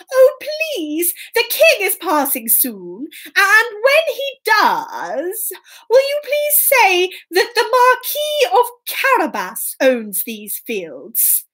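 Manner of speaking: high, swooping entreaty; pleading Puss. Acted. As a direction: wheedle the workers, stretching key words. Puss begs the field workers.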